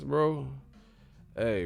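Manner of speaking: ordinary delivery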